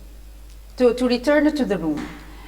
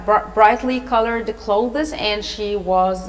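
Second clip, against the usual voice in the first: ordinary delivery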